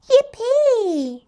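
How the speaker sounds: very high, loud squeal of joy; excited Yippee. Acted. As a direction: cheer like a thrilled little pig. Peppa cheering at aquarium.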